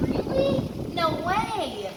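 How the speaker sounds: answering the friend in feigned disbelief; mock surprise. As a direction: pretend to be amazed. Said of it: "I'm like".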